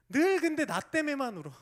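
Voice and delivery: loud, high exclamation; rebuking shout. Real, not acted. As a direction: call them out sharply. Preacher rebukes self-pity. (자기연민을 꾸짖는 설교자, 높고 큰 소리로)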